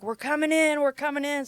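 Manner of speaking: imitated high call-out; retold raid call. Retelling what the SWAT team called at the door, mock shout.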